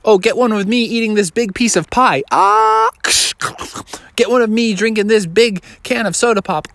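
excited bear calling for photos; eager show-off bear. Acted. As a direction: ham it up for the camera.

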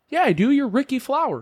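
high-pitched, breezy retold comeback; nonchalant. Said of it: "He's like, real nonchalant".

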